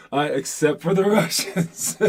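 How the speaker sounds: slow words broken by a laugh. Real, not acted. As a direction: chuckle through the punchline. Laughing at own joke.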